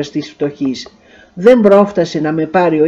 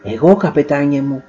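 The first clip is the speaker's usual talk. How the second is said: woman's voice; 4 semitones lower than usual. A narrator's reading. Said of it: "απάντησε μια γυναικεία φωνή".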